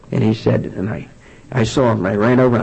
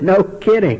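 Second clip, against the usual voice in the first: higher-pitched dry comeback; retold ex-wife's unimpressed retort, pitched up; 7 semitones higher, slower than usual. Ex-wife answers his confession of alcoholism, dry retort.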